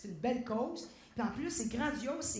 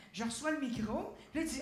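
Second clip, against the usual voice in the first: ordinary delivery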